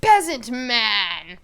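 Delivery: mock-pompous noble hailing a peasant; haughty swordsman. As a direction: call out imperiously, stretching the last words. Acting swordsman accosting a peasant.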